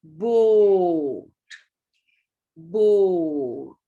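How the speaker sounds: Boat said long and loud, the o stretched out; drawn-out long vowel. Shown as a demonstration. Teacher stretching Boat's long o for pupils. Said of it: "what do we say?".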